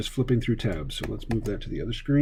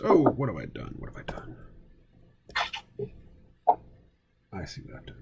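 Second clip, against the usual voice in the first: whispered dismay; 9 dB quieter than usual. Real, not acted. Dismayed muttering while drawing.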